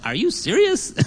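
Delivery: incredulous squeal of a question; jaw-dropped disbelief. Retold or imitated. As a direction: replay your stunned reaction, pitch shooting up. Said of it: "my chin just dropped to the floor".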